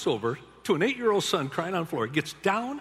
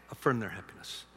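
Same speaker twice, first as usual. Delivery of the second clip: usual speaking voice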